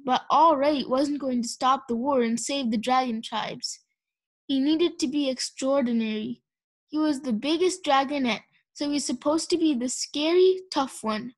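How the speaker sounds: usual speaking voice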